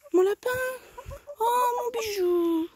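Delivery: very high, slow doting coo; cooing pet talk. Real, not acted. Doting on her hen, a very high slow coo. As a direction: melt over the little hen.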